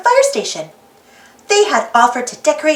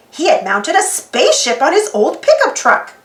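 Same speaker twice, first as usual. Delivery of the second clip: usual speaking voice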